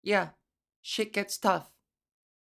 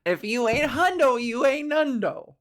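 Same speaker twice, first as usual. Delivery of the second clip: bigger catchphrase; 4 semitones higher, 5 dB louder than usual. Real, not acted. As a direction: hammer the rhyme home. Repeats rhyme bigger.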